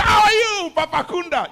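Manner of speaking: loud, high, overdone hello; effusive mock greeting. Preacher plays a two-faced man greeting Papa Kunda, loud gushing mock cheer. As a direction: greet him with hugely exaggerated delight.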